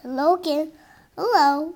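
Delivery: ordinary delivery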